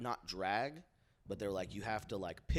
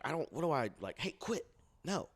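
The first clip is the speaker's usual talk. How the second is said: high-pitched, halting mock objection; mock protest; 7 semitones higher, 4 dB louder than usual. Telling friends how he objected, mock high protest. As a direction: fumble a protest in a raised voice. Acting out weak protest during story.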